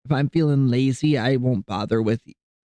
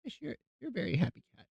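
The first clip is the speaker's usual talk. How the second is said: cooing to cat; 9 semitones higher, 14 dB quieter, much faster than usual. Real, not acted.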